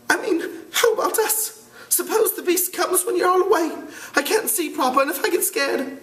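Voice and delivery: very high, tearful plea; scared whiny boy. Acted. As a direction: whimper like a frightened kid. Piggy pleading, frightened.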